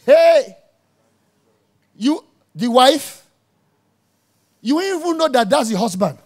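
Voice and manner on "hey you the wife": calling out. Retold or imitated. Mock calling out to a wife.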